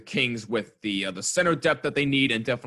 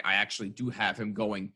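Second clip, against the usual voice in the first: ordinary talk, nothing marked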